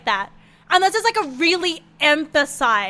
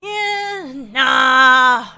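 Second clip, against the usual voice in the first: reluctant refusal; 7 dB louder, much slower than usual. Retold or imitated. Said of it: "you don't wanna say".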